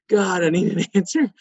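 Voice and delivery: mock desperation, laughing through it; laughing mock plea. Husband begs his wife for an answer, laughing mock plea.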